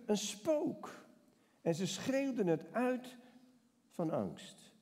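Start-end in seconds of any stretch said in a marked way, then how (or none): 0.1-1.1 high, startled cry of the disciples; a touch of panic in the cry; mild frightened cry. A narrator's reading. Preacher voicing the frightened disciples, a high mild cry. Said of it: "En ze riepen".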